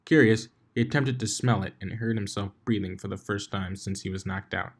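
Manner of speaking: usual speaking voice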